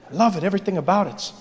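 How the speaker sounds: ordinary talk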